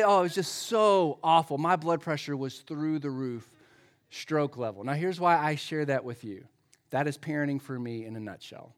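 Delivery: normal talking voice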